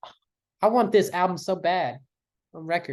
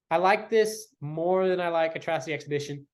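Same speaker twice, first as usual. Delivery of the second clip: usual speaking voice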